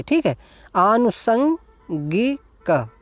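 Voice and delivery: आनुषंगिक stretched out slowly, stressing मूर्धन्य ष; slow pronunciation. Shown as a demonstration. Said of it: "मूर्धन्य सा बनाएंगे".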